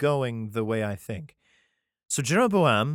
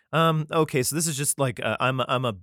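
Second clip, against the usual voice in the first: usual speaking voice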